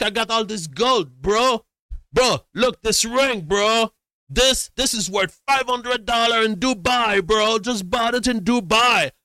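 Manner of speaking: high, loud, insistent sales plea; hammed-up frantic haggler imitation; pushy hustler. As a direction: push the deal loudly in a high voice. Imitates man hawking gold for cash.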